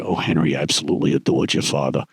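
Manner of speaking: put-on an old Marine buddy gushing about Dad; imitating Bill. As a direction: drop low and gush a little. Friend Bill voiced greeting Henry.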